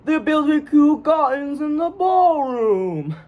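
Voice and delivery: very high, slow, over-the-top Cockney; drawn-out Cockney. Retold or imitated. Actor recreating his line from the show, long Cockney. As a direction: stretch every word in a bad high Cockney. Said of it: "remember exactly how I said it".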